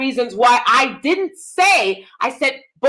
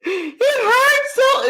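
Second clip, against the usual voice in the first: high, very slow mock wail; fake crying; 10 semitones higher, much slower than usual. Speaker showing an unbelievable reaction to a cat scratch, hammed-up crying. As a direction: overdo the tears, dragging out each word. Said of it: "trying to cry and highly emoting".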